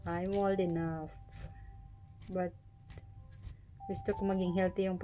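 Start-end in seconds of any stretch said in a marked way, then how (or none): none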